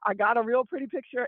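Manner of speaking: ordinary talk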